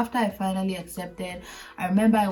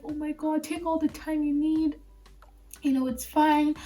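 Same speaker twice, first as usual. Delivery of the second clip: high-pitched, soothing reassurance; acted consoling; 8 semitones higher than usual. Lawyer gives crying intern time off, high-voiced consoling. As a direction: console someone in a high, sympathetic voice.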